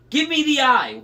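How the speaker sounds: high, loud wailed demand; wailing. A narrator's reading. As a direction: wail for it at high pitch. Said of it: "Wasp wailed".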